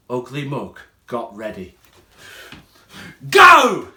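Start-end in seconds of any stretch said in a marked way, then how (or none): 3.3-3.9 a loud, high yell. Acted. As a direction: bark the signal to charge. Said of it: "he yelled".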